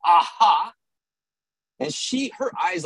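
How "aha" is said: loud, higher accusing aha; classic gotcha aha. Shown as a demonstration. Storyteller demonstrating the classic Al-Anon aha, accusing. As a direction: show off a textbook "caught you". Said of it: "It was a classic Al-Anon aha".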